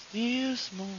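hushed, drawn-out faint sound; still small voice. Retold or imitated. Preacher imitating the still small voice Elijah heard, hushed. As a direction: let it drift in softly, barely there. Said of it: "he heard a noise".

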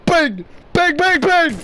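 loud mock gunshots, rising; shouted bang bang. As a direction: yell each bang like a shot. Shouting bang while firing.

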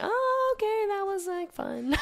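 high-pitched, drawn-back "oh, okay" act; mock grudging approval. Athlete admitting the hated workout was fun, mock grudging. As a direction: play up a squeaky concession. Changing her mind after workout, retold.

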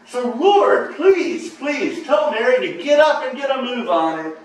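high, loud, exasperated pleading; frustrated plea. Acted. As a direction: beg the Lord, at the end of your rope. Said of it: "in utter frustration".

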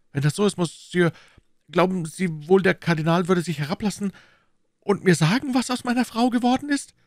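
deferential mercer, pitch climbing into the question; hopeful prisoner. Acted. Mercer asking after his missing wife, hopeful and humble. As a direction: ask timidly, rising high at the end. Voices Bonacieux pleading.

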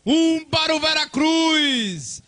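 high, loud goal commentary; excited shouting. Real, not acted. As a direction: keep yelling with the crowd's energy.

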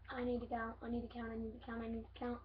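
soft rushed muttering; muttering to himself. Real, not acted. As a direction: mumble it under your breath. Muttering to self.

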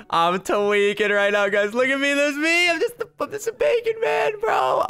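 very high, excited squeal; real hyped freak-out. Real, not acted. Player seeing himself in the interview room, freaking out excitedly.

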